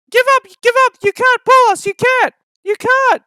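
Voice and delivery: very high, loud taunt; taunting trucks. Acted. The trucks jeering at James, very high and loud. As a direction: jeer like naughty trucks. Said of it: "called the trucks".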